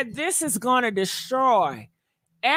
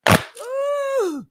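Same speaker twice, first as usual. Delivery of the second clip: a sudden squealed ooh, much higher; high ooh of delight; 12 semitones higher than usual. Real, not acted. As the spirit hits her over the rumour, high squealed ooh.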